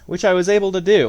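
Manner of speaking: quick, bright burst of pride; pleased with yourself, pace picks up; excited. Real, not acted. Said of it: "which I was pretty excited about".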